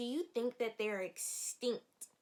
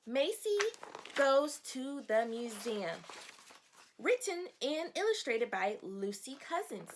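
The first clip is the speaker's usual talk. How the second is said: announcing the title; 2 semitones higher, 3 dB louder than usual. Acted. Teacher unveiling the book title to her class. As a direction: savor each word of the title.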